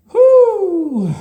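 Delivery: a sharp high loud whew; a big high "whew" before the insult. Real, not acted. Exhale before judging friend.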